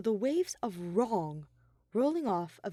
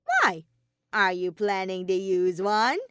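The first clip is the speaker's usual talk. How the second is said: Monokuma voice; 7 dB louder than usual. A narrator's reading. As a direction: needle him with a sly question.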